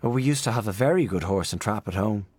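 plain reading voice

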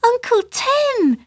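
put-on very high, drawn-out girlish call; high excited greeting; child's shout. A narrator's reading. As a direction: call out gleefully as a little girl. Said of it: "shouted the girl".

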